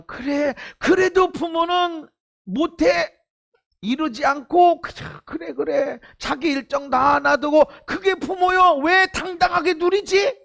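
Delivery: ringing pulpit voice, pressing each point; preaching at full force. Acted. Pastor urging the congregation, preaching at full force. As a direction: preach it out to the whole hall.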